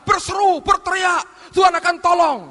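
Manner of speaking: high, loud preaching call; fiery preaching. Acted. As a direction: preach it with full force. Preaching at rising force. (khotbah berapi-api yang keras)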